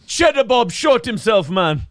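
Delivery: slow, high comic Jamaican voice; Jamaican accent. Acted. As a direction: stretch it out in Jamaican. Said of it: "Him's going to Jamaica".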